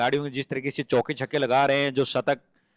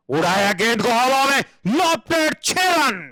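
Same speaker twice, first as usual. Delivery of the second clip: rising, excited call of a six; excited cricket commentary; 8 semitones higher, 4 dB louder, slower than usual. Acted. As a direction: build up to the big hit.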